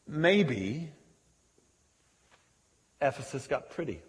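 ordinary delivery

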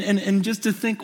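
ordinary talk, nothing marked

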